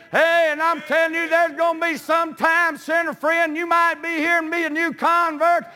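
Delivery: high, driving revival preaching; preaching at full force. Real, not acted. Preacher urging new converts to cut the ties, preaching at full force. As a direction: preach hard in a high, sustained cry.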